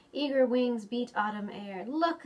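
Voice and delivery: ordinary delivery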